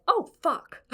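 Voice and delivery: high, startled oh no; sudden rush of panic; panicked gasp. Retold or imitated. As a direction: caught out and horrified. Panicked inner reaction in story.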